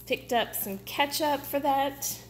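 usual speaking voice

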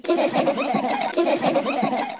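high, quick acted giggle; squeaky tittering laugh; rodent giggling. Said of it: "couldn't help giggling".

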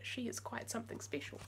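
usual speaking voice

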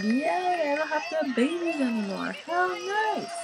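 high, bright mock cheer for the sim; playful high voice for the sim celebrating; mock happy sim. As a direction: squeal it like the relieved mother sim. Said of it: "She's like".

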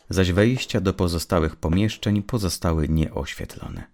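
ordinary delivery

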